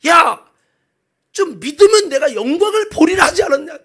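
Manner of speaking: loud, high exasperated scolding; worked-up anger. Acted. Jesus rebukes doubting Martha at the tomb, worked-up anger. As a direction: scold as if losing patience. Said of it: "주님은 막 흥분해서 화를 냅니다".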